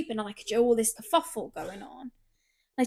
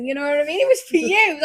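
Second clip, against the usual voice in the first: usual speaking voice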